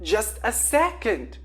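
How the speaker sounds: loud call back; shouting back. A narrator's reading. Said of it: "the girl shouted back".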